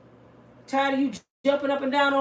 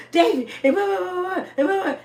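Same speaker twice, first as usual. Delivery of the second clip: higher, rushed blah-blah rant; mock excited rant; 9 semitones higher, 5 dB louder than usual. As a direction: play the worked-up mom gushing at her son. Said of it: "so upset and excited about something".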